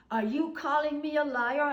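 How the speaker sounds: high, loud indignant challenge. Retold or imitated. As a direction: hurl the accusation back. Neighbour's nasty quarrel.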